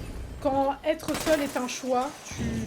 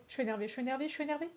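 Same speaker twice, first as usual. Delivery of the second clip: softer, muttered over and over; muttering repeat; 8 dB quieter, much faster than usual. Real, not acted. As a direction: repeat under her breath, fed up.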